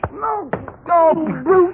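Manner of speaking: high, panicked plea under blows; pained cry. Acted. Krug crying out in pain while Butch hits him. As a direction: cry out as the thug hits you.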